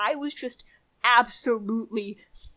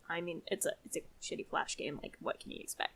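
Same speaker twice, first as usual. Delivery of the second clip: soft, unvoiced throwaway under the breath; muttered aside; 6 semitones lower, 14 dB quieter, faster than usual. Real, not acted. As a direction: mumble it to yourself, dismissive. Dismissive aside.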